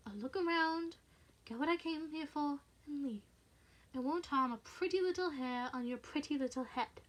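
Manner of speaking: creepy, lilting persuasion; sinister man toying with his victim; coaxing intruder. Acted. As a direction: wheedle with veiled menace. Intruder coaxes her menacingly.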